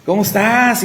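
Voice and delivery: high, warm-hearted hello; happy greeting. Retold or imitated. The elevator technician recognizing him, delighted high greeting.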